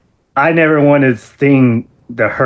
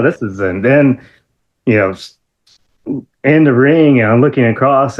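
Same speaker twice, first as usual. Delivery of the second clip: ordinary talk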